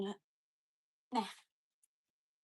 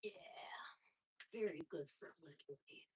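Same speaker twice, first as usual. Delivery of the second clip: ordinary talk, nothing marked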